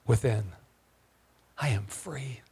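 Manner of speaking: whispered, unvoiced affirmation. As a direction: breathe the words out softly as a vow.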